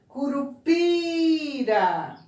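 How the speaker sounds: Curupira slowly, with a high, drawn-out PI; stress on PI. Shown as a demonstration. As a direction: hold the PI in Curupira. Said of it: "Qual é a mais forte?".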